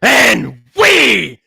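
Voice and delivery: loud hyped-up livestream opener, shouted and stretched out; hype intro shout. Acted. Host opening his Friday night livestream to the chat, a loud hype shout. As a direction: rear back and blast the intro like a ring announcer. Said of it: "deliver an intro as only I can".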